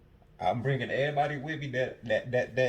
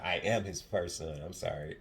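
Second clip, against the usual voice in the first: usual speaking voice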